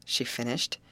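ordinary delivery

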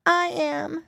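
put-on high little-girl voice, lightly tearful; a touch of a sob, performed gently; sobbed reply. A narrator's reading. Lost girl answers the piggy boy, mildly sobbing. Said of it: "sobbed a voice".

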